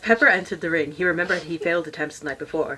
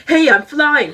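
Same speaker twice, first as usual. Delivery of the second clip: high, loud gleeful cry; excited shout; 9 semitones higher, 11 dB louder, slower than usual. Acted. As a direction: shout it with joy in the air. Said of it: "he shouted".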